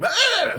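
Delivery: high sudden yelp; imitated tic outburst. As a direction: blurt a high Ah out of nowhere. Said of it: "they will just make an outburst".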